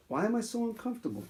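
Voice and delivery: soft, quick, pouty princess complaint; soft, quick spoiled princess whining; pampered princess voice. Acted. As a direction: whine it like a spoiled princess sitting on a pea. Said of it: "says the American princess".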